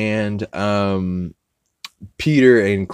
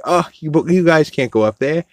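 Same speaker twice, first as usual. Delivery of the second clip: put-on nerdy kid warning; 8 semitones higher, 5 dB louder than usual. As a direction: fret like a worried nerd. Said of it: "He's like".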